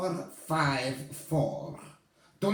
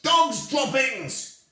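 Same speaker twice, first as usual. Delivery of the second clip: yelling; 5 semitones higher, 3 dB louder, slower than usual. Acted. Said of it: "she yelled".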